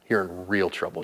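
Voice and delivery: normal talking voice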